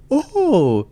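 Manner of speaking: high, breezy, unconcerned mock voice; mocking the careless owner. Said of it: "not concerned at all".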